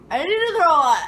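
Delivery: imitating talk through a full mouth; high, loud mock queasy plea; mouth full of vomit. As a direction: blurt it loudly as if about to retch. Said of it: "I have all this puke in my mouth".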